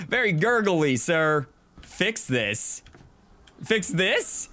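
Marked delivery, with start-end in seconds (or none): 3.6-4.5 rising disbelief, pitched higher; puzzled echo of an order; incredulous. Real, not acted. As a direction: fix this as if it's absurd. Incredulous at the odd request.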